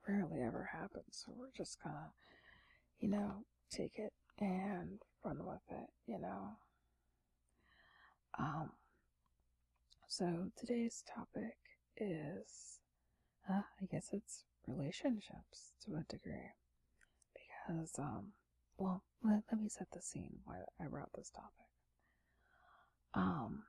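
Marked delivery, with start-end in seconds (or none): 1.2-5.9 sleepy late-night whisper. Real, not acted. ASMR whisper throughout. 10.1-21.5 sleepy late-night whisper. Real, not acted. ASMR whisper throughout.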